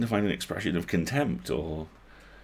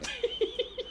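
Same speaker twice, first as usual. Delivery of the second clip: high laugh; 7 dB quieter than usual. Acted. Laugh burst.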